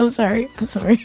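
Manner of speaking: apologising through real laughter; giggly sorry; laughing apology. Real, not acted. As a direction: say sorry while still giggling. Laughing after the joke.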